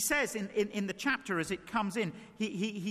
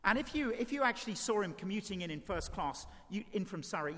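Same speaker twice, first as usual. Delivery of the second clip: ordinary delivery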